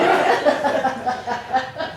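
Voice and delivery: bright burst of laughter; real laugh. Real, not acted. Eulogy speaker remembering her friend, laughing out loud.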